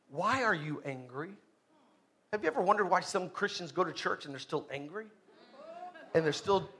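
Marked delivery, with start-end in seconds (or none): 0.1-1.3 soft, low question, rising at the end; quiet questioning. Acted. The Lord asking Cain about his anger, quiet questioning. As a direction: ask it low and gently probing.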